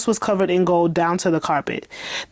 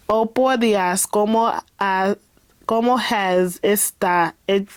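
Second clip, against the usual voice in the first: shaky Spanish, read carefully and slowly; Spanish line; 2 semitones higher, slower than usual. Acted. Reader stumbling through a Spanish greeting, slow and unsure. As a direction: sound out the Spanish like a beginner. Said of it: "this next part is in Spanish".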